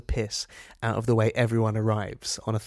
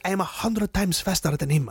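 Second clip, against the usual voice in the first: imitated foreign driver's accent, pitched higher; higher voice in a mock foreign accent; put-on Alonso accent; 7 semitones higher than usual. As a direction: mimic a boastful driver in a rough accent. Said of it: "why I did that accent".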